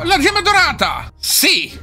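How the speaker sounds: thrilled at the find; excited find. Real, not acted. Finds the golden gem. (entusiasta, voce molto più acuta)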